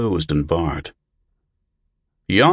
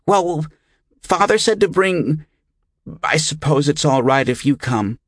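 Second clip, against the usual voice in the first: put-on high child's voice, hesitant; doubtful boy; 9 semitones higher than usual. A narrator's reading. Boy unsure about taking Brother Albert instead, doubtful. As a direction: relent doubtfully, pitched up like a child. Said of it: "Yonkel looked doubtful".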